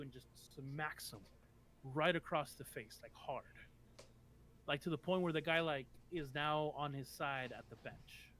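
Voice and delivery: normal talking voice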